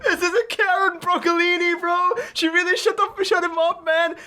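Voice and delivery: cracking up at the tackle, voice way up; real giddy outburst at the cartoon; excited laughing reaction. Real, not acted. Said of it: "This is genuinely how I reacted".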